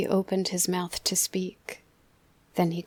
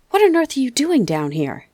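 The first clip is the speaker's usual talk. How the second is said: loud, higher, startled demand; startled father; 7 semitones higher, 10 dB louder than usual. Acted. Father finding his son outside at night, loud surprised demand. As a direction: catch him red-handed, loud. Father finds boy in garden.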